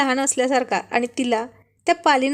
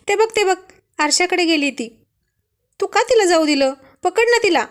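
higher, louder, demanding and scared; insistent shout; 6 semitones higher, 4 dB louder than usual. A narrator's reading. Wife shouts at husband to grab lizard, high insistent yell. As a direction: yell at him to catch the lizard. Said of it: "हट्टाने त्याला ओरडत होती".

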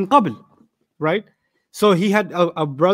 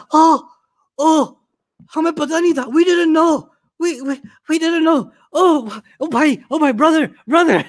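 mock flustered apology; 8 semitones higher than usual. Embarrassed customers apologize.

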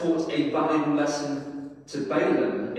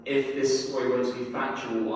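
usual speaking voice

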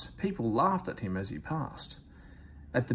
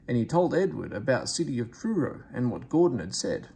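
ordinary delivery, nothing marked

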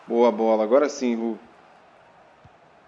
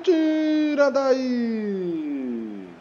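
excited build-up; 7 semitones higher, much slower than usual. Real, not acted. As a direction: hold the note as the ball flies. Goal chance building.

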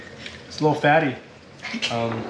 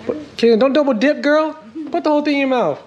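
calling out; 13 semitones higher, 3 dB louder than usual. Real, not acted. Scolding kid at table, loud high.

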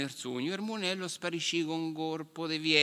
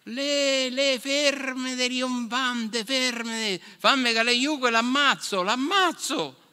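high, loud bellow, slow at first; furious shout; 9 semitones higher, 5 dB louder, slower than usual. A narrator's reading. Giant warrior yelling at his fleeing elephant, high and loud. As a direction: yell at the runaway beast to stop. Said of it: "il guerriero grande gli grida".

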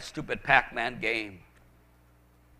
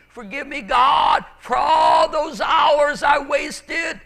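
building from raised pitch to a high shouted plea; impassioned prayer; 10 semitones higher, 6 dB louder than usual. Real, not acted. Preacher begging God's forgiveness for wasted hours, impassioned prayer. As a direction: cry out to God with mounting fervor.

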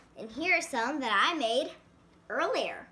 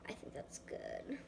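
whispering; 14 dB quieter than usual. Real, not acted. Checks work under breath.

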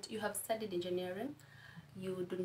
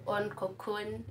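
ordinary talk

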